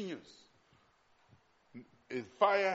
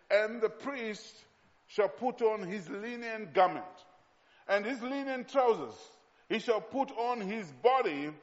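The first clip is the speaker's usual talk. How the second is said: higher, measured verse reading with pauses between phrases; emphatic scripture reading; 5 semitones higher, 4 dB louder than usual. Acted. Preacher reads the priest's instructions to the congregation, emphatic scripture reading.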